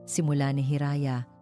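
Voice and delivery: usual speaking voice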